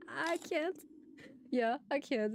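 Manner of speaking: high, breaking up with laughter; can't get it out for laughing; real laughing. Real, not acted. Amused by colleagues' pronunciation, laughing. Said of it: "This is too funny".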